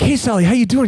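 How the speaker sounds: high-pitched, friendly acted hello; lifted, sing-out retold hello; cheery greeting. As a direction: act out an upbeat greeting to a newcomer. Voices cheery greeting in story.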